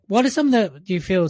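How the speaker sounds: ordinary talk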